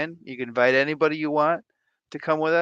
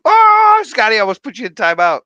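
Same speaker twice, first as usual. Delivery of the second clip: mock scolding; 8 semitones higher, 15 dB louder than usual. Scolding a viewer playfully.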